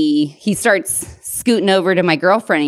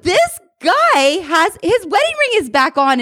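mock disbelief; 12 semitones higher than usual. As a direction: drag it out in shocked disbelief. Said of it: "I'm like".

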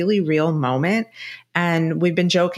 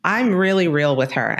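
normal talking voice

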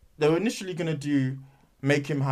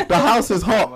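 high, loud, through laughter; giggling tease; laughing punchline; 6 semitones higher, 4 dB louder, slower than usual. Real, not acted. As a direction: land the joke while cracking up. Joke about argument at home.

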